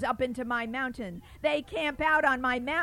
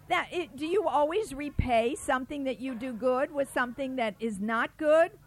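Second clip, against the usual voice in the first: ordinary delivery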